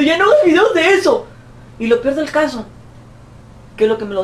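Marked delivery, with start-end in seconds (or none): 0.0-1.3 mocking critic. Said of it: "gente que está diciendo".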